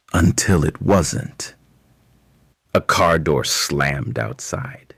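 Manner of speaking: ordinary delivery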